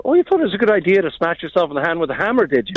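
mock incredulity in Irish; Irish accent that turns it into a question; Irish question lilt. Shown as a demonstration. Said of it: "part of that question thing".

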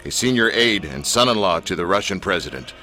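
low, deep announcer read; dramatic trailer narration over music; radio voice. Shown as a demonstration. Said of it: "use my best radio voice".

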